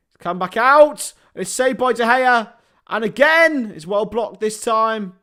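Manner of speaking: football commentary building to loud, high peaks; live play-by-play. Real, not acted. Streamer calls West Ham corner to viewers, excited play-by-play. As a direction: call the attack, rising as shots come in.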